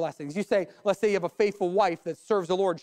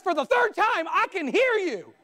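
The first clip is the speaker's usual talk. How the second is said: high-pitched, drawn-out exasperation; exasperated wife; 10 semitones higher than usual. Acted. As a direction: act fed up, slowing on the last words. Wife's exasperated punchline.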